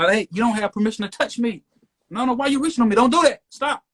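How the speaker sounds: high, urgent objection; retold protest. Driver telling officer not to touch him, high-pitched protest.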